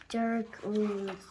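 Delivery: ordinary delivery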